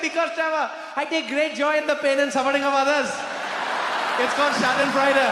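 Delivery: put-on high, gleeful Indian-accented waiter; loud Indian-accented punchline. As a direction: relish the confession in an Indian accent. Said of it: "you start talking with accent".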